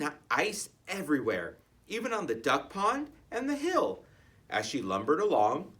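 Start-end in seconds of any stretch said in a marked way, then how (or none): none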